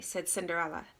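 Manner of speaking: plain reading voice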